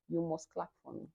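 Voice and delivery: ordinary delivery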